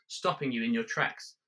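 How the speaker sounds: ordinary delivery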